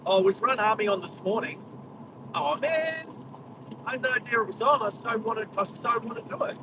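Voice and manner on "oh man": high, let-down oh man; disappointed groan. Real, not acted. Driver to viewers on missing the run, disappointed. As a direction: sag with real disappointment. Missed the run army event.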